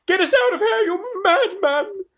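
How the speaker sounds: high, loud screech, slowed; screaming grandma. A narrator's reading. Grandma Josephine screams at Mr Wonka in terror, high shriek. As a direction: shriek it like a terrified old woman. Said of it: "screamed Grandma Josephine".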